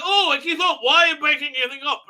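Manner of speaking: high, loud hammed-up complaint; mock exasperation. Companion scolding the attacker in a retelling, high mock exasperation. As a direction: imitate her, rushing into a high protest.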